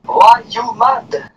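drawn-out taunt. Real, not acted. Repeating a taunt at an annoyed teammate, needling. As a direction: goad them, slow and loud.

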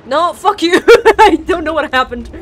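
loud startled shout into laughter; loud startled shout at the game turning to a laugh; startled yell. Real, not acted.

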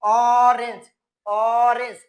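অরেঞ্জ said loud and clear, opening on an ɔ vowel with no w; pronunciation demo. Shown as a demonstration. Teacher showing students how orange sounds, a loud, clear demo. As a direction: spell out অরেঞ্জ loudly for the class, starting on a plain vowel. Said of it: "তো এখানে উচ্চারণটা কিভাবে আসছে?".

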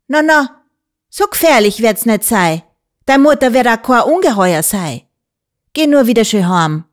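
put-on calm the frightened child down; motherly woman reassuring; comforting. A narrator's reading. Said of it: "redete sie mir zu und tröstete mich".

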